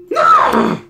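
very high, panicked yell; desperate scream. Real, not acted. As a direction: cry out as the piece slips back. Nearly sent back again.

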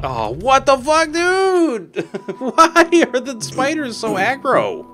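high, loud, drawn-out squeal of disbelief; slow, squealing protest at the game; shocked outcry. Real, not acted. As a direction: wail in outraged surprise, stretching the words. Spider grabs partner, player cries out.

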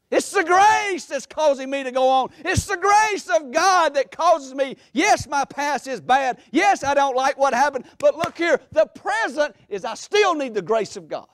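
high, driving pulpit proclamation; impassioned preaching. Acted. Preacher voicing Paul's testimony of grace, impassioned. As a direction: proclaim it with rising fervor. Preaching at full force as Paul.

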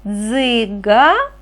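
дзига-а with the last А held long; drawn-out final sound. Shown as a demonstration. Teacher showing children the last sound, дзига-а with lengthened final А. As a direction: sound out дзига-а slowly, stretching the ending. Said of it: "І останній звук".

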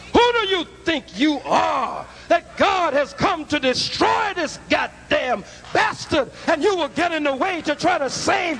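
thundering, outraged pulpit shout; furious preaching. Real, not acted. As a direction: roar the rebuke at full force. Preacher rebukes would-be saver at climax.

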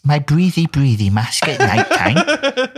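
high, slow mock-proud child voice; proud schoolboy. Reporting to the teacher-like clinic, high mock schoolboy pride. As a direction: boast like a kid seeking a gold star.

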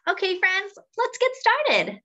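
put-on cheery kickoff to a children's story; excited. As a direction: lift it up like starting a game for kids. Host kicks off story time.

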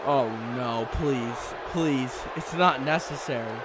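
low, soft, dismayed plea; hushed pleading. Real, not acted. Commentator begs the attacker to stop, hushed and low. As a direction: beg quietly for mercy.

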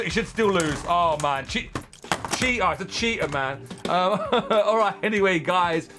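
ordinary delivery

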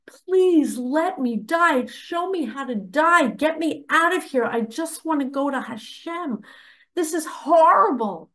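retold crying out. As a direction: plead as if begging for release, retelling it. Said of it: "I'm crying out".

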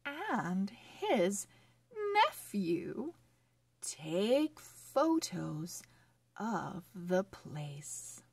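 slow, careful storybook reading; reading to children. Acted. Teacher reading a phonics story to children, slow and clear. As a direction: read each word clearly for young readers.

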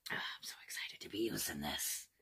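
soft, breathy thrilled whisper; excited whisper. Real, not acted. Telling viewers she's thrilled to use it, whisper. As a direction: whisper it close to the mic.

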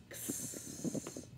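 X as a voiceless "ks" with a long hiss; letter sound. Shown as a demonstration. Teacher drilling letter sounds, X as "ks".

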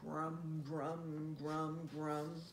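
low, soft chewing grum; hippo chewing. Shown as a demonstration. Reading to a child, chewing like a hippo. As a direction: chew it like a hippo. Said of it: "hippo chewing gum".

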